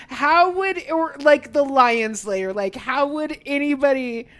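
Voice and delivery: normal talking voice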